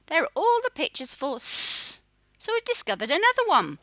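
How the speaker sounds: ordinary talk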